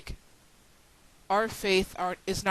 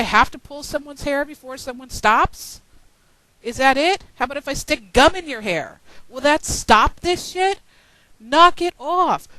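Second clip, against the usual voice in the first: angry scolding; 5 semitones higher, 6 dB louder than usual. Real, not acted. Telling off the bickering pagan community, a loud angry scolding.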